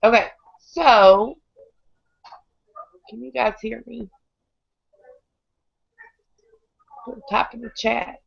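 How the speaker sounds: hoarse, strained voice; croaking through a sick throat; lost voice. Real, not acted. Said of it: "I don't have any voice tonight".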